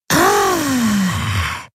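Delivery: long high drawn-out ah; childlike fascinated ah. A narrator's reading. Old Voi sees the helix, childlike fascinated high ah. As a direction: marvel at it like a child. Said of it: "in fast kindischer Faszination".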